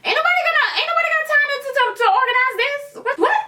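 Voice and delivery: very high squeaky mock voice; hammed-up high-pitched complaint; mocking rude employee.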